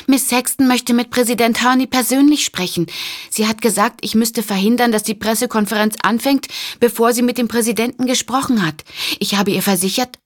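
a flustered operator calling to get through the crowd. Acted. Operator voice, high and urgent.

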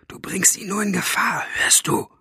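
urgent whisper into the ear; hissed whisper. A narrator's reading. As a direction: whisper a stern warning. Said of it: "zischte Elinor ihr ins Ohr". (eindringlich ins Ohr geflüstert)

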